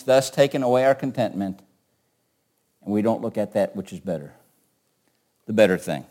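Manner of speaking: usual speaking voice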